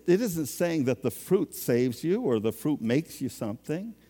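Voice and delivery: ordinary talk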